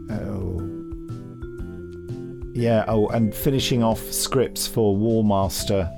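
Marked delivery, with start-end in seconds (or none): none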